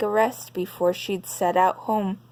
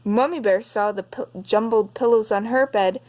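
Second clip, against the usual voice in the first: usual speaking voice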